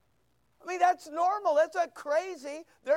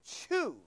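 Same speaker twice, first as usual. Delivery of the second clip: "choose" lifted high but said softly; single out "choose", higher and hushed; stress on choose; 4 semitones higher, 6 dB quieter than usual. Shown as a demonstration. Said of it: "notice the word".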